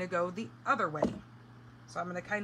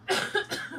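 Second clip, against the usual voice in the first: a short dry cough; 12 semitones higher, 4 dB louder than usual. Real, not acted. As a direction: cough once to clear throat. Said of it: "a frog in my throat".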